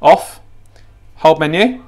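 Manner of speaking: ordinary talk, nothing marked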